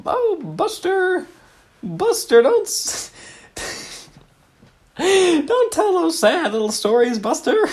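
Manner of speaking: very high, slow, prim mock voice; silly character voice. Improv partner chiding Buster in a very high prim voice. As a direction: coo it like a fussy old dear.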